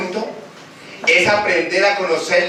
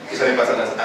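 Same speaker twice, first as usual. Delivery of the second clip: normal talking voice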